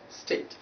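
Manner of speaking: "state" as "stet"; wrong short vowel. Shown as a demonstration. Teacher showing what not to say, short e. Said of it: "not state".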